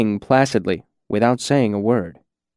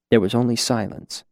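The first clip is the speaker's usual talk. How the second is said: plain reading voice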